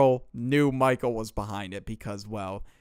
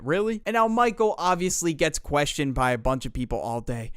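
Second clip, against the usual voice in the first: normal talking voice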